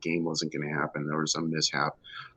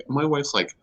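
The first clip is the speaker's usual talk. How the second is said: normal talking voice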